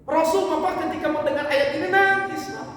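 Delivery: high, loud declaration; emphatic preaching. Real, not acted. Preacher telling the congregation the Prophet wept, loud and high. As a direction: proclaim it to the congregation.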